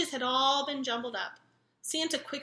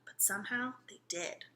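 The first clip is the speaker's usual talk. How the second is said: ordinary delivery, nothing marked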